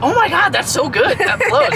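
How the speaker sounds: imitating a thrilled friend; excited gushing. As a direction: gush like she just heard something brilliant. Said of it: "she was like".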